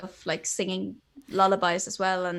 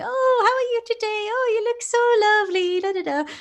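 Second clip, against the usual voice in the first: very high, loud singsong cooing; exaggerated lilting parentese, trailing into da-da syllables; sing-songy baby talk; 7 dB louder than usual. Shown as a demonstration. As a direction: gush over a baby, pitched way up. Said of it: "the sort of sing-songy".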